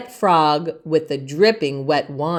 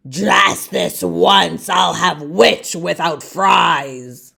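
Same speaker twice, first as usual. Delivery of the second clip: slow, relishing dragon; a hint of a sly dragon; gloating dragon; 3 semitones lower than usual. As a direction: savour each word like a hungry dragon. Dragon gloats before eating.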